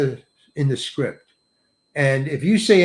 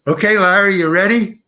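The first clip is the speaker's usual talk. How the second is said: raised, higher director's call; 4 semitones higher, 4 dB louder than usual. Retold or imitated. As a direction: call out to the actor down the road. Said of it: "He calls me".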